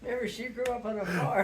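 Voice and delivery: laughing through the explanation; half-laughing excuse. Real, not acted. Laughing while defending aunt.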